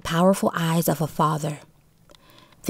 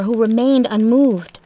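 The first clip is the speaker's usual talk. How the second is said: ordinary delivery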